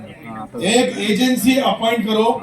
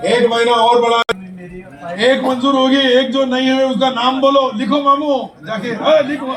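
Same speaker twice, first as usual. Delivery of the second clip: raised voice, louder over the crowd; calling out orders; 4 dB louder than usual. Real, not acted. Official hurrying his helper to write down names, calling out. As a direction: call it out loudly to the room.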